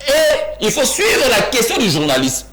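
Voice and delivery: high, slow, laughing through the words. Real, not acted. Pundit mocking the question, laughing high. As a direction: scoff with a laugh as you say it. Heated debate, laughs mid-line.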